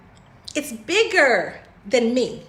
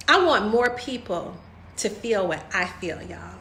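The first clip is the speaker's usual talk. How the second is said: choked up; 5 semitones lower, 5 dB quieter than usual. Real, not acted. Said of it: "I'm about to get choked up".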